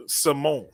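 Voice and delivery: salmon said slowly as "Simone", suh-MOAN; slow down and insist on "Simone"; drawn-out "Simone" for salmon, stressed on the end; odd pronunciation. Pretend. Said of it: "What are you saying?".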